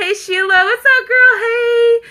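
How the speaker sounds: high, loud squeal of hello; excited greeting. Real, not acted. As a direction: call out gleefully to an arriving friend. Greets a viewer joining the live.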